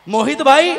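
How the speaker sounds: loud call of a name. Real, not acted. Comedian calling out to a man in the audience, loud. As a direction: call him out across the crowd.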